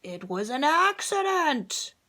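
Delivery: lightly voiced dragon roar. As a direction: protest as the dragon. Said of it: "he roared".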